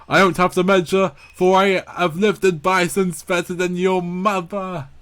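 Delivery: hammed-up royal swagger; boastful king. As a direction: puff up the brag. King boasts to his guard.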